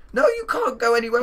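very high, loud excited cry; urgent protest. Real, not acted. Stopping a tired friend from leaving, high urgent protest. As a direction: shoot up high and loud to stop them.

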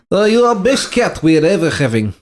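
hammed-up Eastern European accent, laughing. Dad redoing his son's line in Eastern European accent. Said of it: "if you put it in an accent".

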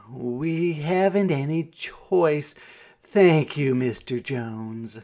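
mildly resigned, slowed speech; resigned sigh. A narrator's reading. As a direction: give in slowly after a sigh. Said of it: "Mama sighed".

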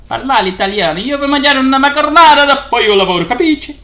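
loud, high, fast hammed-up Italian; over-the-top Italian outburst, breaking into laughter; mock Italian. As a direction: rattle off showy Italian at full volume. Hammy burst of Italian.

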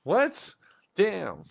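sudden high cry of disbelief; shocked. Real, not acted. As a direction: blurt it out, stunned. Player's character just killed.

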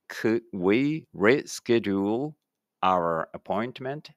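slow, carefully spaced English for the class to repeat; slow model reading. Shown as a demonstration. Teacher models English for pupils reading aloud together, slow and clear. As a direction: say each word slowly and clearly, like a teacher modeling. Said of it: "一緒に声出してください".

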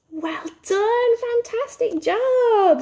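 high, drawn-out congratulations; delighted praise. Pretend. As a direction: cheer a child's success. Teacher praises children's correct answer.

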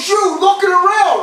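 high-pitched imitated officer's holler; military voice. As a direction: call him out like a drill sergeant. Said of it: "military voice goes".

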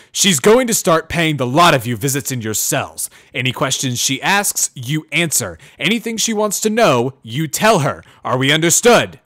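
loud, booming guard barking orders at prisoners; noisy guard. A narrator's reading. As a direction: boom it out like a stern jailer. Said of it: "says the noisy guard".

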